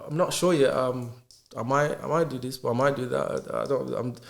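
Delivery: halting, indecisive reply; not confident. Acted. Unsure person answering what they are doing, not confident. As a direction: stumble and waver from one option to another. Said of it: "that's not confidence".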